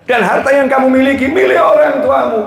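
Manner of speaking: high, emphatic preacher's declaration; impassioned preaching. Real, not acted. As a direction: drive the point home high and firm.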